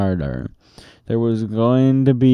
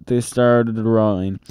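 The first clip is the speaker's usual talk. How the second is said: ordinary delivery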